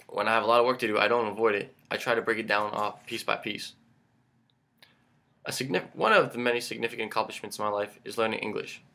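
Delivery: usual speaking voice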